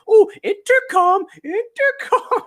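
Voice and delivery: very high, loud mocking singsong; hammed-up schoolkids mimicking a prissy accent, stretched out; mocking teasing. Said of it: "how I got teased so much".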